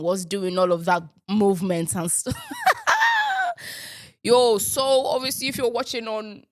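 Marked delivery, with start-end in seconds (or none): 2.4-3.8 high squealed laugh. Real, not acted. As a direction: burst into a high giggle. Laughs at own silly greeting.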